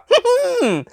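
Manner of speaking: high cheerful chuckle; high laugh. Acted. Doctor laughs.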